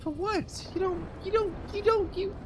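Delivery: can't believe what he's seeing, pitch shooting up; squeaky incredulous rant. Real, not acted. Ranting at shop owner's purchases.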